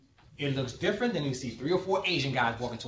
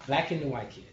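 normal talking voice

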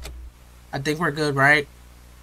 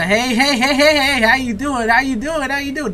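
much higher, louder, placating; nervous appeasing greeting; 10 semitones higher, 5 dB louder than usual. Real, not acted. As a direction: talk sweetly to the monster so it stays calm. Nervously greeting Chica at door.

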